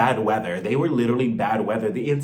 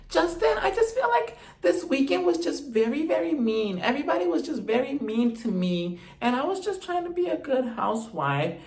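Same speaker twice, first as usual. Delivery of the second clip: put-on much higher, childish whimpering voice; whiny little-girl voice; 8 semitones higher, 3 dB quieter than usual. Wife whining to her husband Justin about a mean weekend, in a high baby voice. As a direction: whine like a hurt little girl. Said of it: "she is crying to him".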